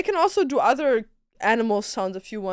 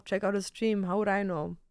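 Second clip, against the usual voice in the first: ordinary delivery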